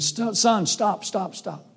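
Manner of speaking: mock-urgent plea to halt; urgent dad calling out. Dad yelling at son to stop.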